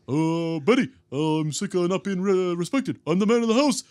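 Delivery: blustering man of the house; Barney asserting himself. Acted. Barney stands up to Betty, puffed-up cartoon bluster. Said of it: "and it's like".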